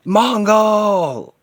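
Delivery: long, high bellowed name; enraged battle cry. Acted. Hero calling out the killer, enraged. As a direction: scream it out with full force, drawn out.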